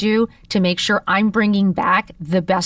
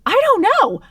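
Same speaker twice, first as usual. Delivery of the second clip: much higher, a cracked little cry; panicked helpless squeak; 3 dB louder than usual. Retold or imitated. Daughter answering her panicking mom with a high helpless squeak. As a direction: squeak it out, overwhelmed.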